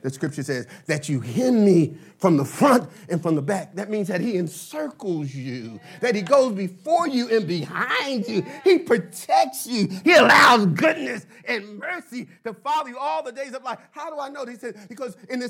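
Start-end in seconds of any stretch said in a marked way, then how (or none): none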